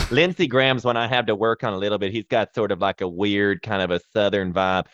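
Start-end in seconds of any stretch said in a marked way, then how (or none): none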